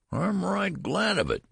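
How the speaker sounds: put-on very high old-man whine; querulous high-pitched old man; whining old man. A narrator's reading. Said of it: "whined the old man".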